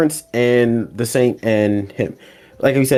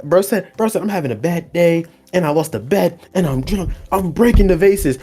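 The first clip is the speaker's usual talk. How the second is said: mock excuse voice; 7 semitones higher than usual. Imagined cabbage dude excuse.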